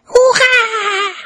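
very high, loud drawn-out cheer; child's hurrah. A narrator's reading. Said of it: "Kann ich noch einmal Hurra rufen".

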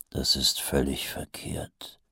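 muttered thought, low and hushed; Vimes's inner voice, hushed. A narrator's reading. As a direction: think it half aloud, low and soft. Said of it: "dachte Hauptmann Mumm".

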